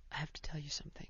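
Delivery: mom whispering a secret; hushed whisper. Retold or imitated. Mom whispering a secret to her daughter in the car, hushed. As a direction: lean in and whisper it, almost no voice.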